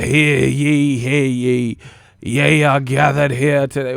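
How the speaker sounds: loud, pompous fake Old English proclamation; hammed-up herald voice; mock medieval voice. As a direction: boom it out like a mock town crier. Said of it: "talking in medieval talk".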